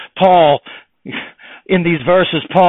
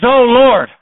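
eager Peter; 9 semitones higher, 8 dB louder than usual. Retold or imitated. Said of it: "He says".

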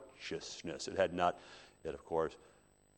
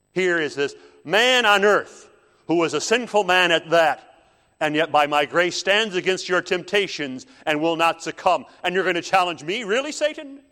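loud, high, scornful challenge; God, taunting; 10 semitones higher, 11 dB louder than usual. Acted. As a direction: shout it to needle a rival's pride. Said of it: "God is poking his pride".

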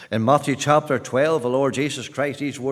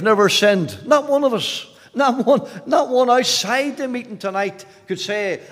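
insistent, raised sermon voice; impassioned preaching; 8 semitones higher, 5 dB louder than usual. Real, not acted. Preacher telling the meeting all have sinned, impassioned. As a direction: drive it home to every listener.

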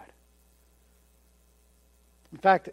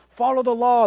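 higher, louder, pushy command; preachy teacher; 5 semitones higher, 7 dB louder than usual. Retold or imitated. Judaizers pressing believers to follow the law, pushy. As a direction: press the rule on them. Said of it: "All the Judaizers, those teachers, they're telling you".

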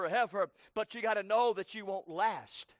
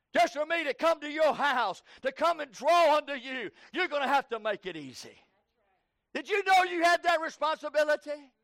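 raised, driving pulpit delivery; impassioned preaching; 5 semitones higher, 4 dB louder than usual. Real, not acted. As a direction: preach it out with force. Preaches at full force.

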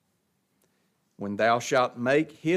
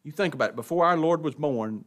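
usual speaking voice

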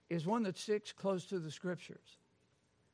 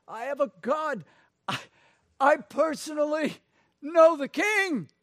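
rising higher and louder with delight; joyful testimony; 8 semitones higher, 8 dB louder than usual. Real, not acted. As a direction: proudly announce you know the king.

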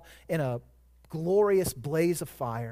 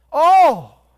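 a sudden full-voiced shout, much higher and louder; shouted answer; 10 semitones higher, 15 dB louder than usual. Real, not acted. Preacher shouting the answer to his own question, full-voiced shout. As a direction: hurl the one word out at the congregation.

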